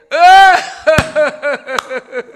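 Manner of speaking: loud, high burst of belly laughter; big laugh. Real, not acted. 농담 결말에 터진 웃음. (크게 터뜨리는 웃음)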